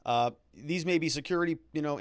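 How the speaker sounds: ordinary delivery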